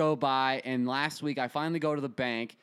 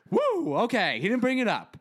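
high, louder burst of relief; a retold sigh of relief, pitched up; relieved woo; 8 semitones higher, 4 dB louder than usual. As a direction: let the tension out in a happy rush. Relief after a tense drive home.

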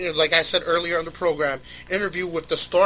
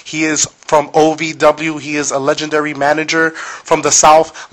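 radio announcer; 7 dB louder than usual. Acted. Host reads the show intro.

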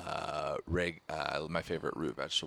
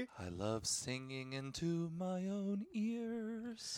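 high, airy vain voice; sing-song falsetto brag; high falsetto boast; 12 semitones higher, 7 dB quieter, slower than usual. Acted. Mock vain singer boast.

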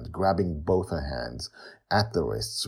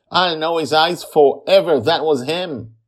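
loud, high, certain; forceful insistence; 11 semitones higher, 10 dB louder than usual. A narrator's reading. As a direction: insist loudly that you are sure. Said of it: "Joy insists forcefully".

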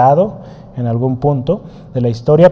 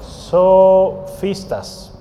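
drawn out very slowly, syllable by syllable; slow dictation; 3 semitones higher, much slower than usual. Shown as a demonstration. Teacher dictates "Sofistas" to the class, very slow. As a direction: spell it out slowly while writing on the board. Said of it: "Voy a escribirlo aquí".

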